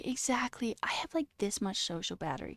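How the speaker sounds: unvoiced whisper; hushed confession. Real, not acted. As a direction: whisper it tiredly.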